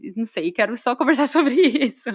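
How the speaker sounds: laughing. Real, not acted. Host laughing while asking guest.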